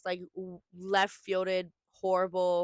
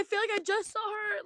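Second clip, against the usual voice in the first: shocked disbelief; 12 semitones higher than usual. Retold or imitated. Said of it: "I was like".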